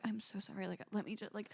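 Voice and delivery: imitating a nervous mic tech, very soft; hushed, tentative mock apology; barely audible, scared to intrude; terrified, timid. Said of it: "so terrified to touch you".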